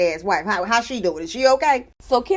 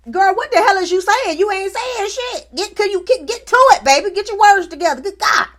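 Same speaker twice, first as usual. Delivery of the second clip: high, louder impatient snap; exasperated retort; 8 semitones higher, 4 dB louder than usual. Retold or imitated. Host losing patience with rambling Stormy, high and exasperated. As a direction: cut her off, fed up.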